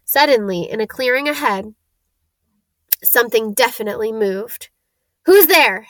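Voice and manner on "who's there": loud, raised challenge; calling out. A narrator's reading. Hagrid challenges whoever moved in the clearing, calling out. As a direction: call sharply to a stranger out of sight. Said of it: "Hagrid called".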